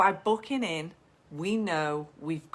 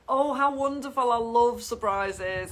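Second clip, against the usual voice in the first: higher and louder, slowly savouring surprises; delighted gushing; 5 semitones higher, 6 dB louder than usual. Acted. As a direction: gush like it's the best news all week. Photographer delights at client's news.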